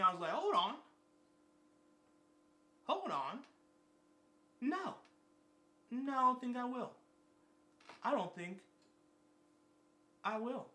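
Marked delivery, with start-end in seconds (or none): 0.3-4.9 high, sharp refusal. Retold or imitated. Refusing the bill. 5.9-10.7 sassy refusal. Retold or imitated. Refusing the bill.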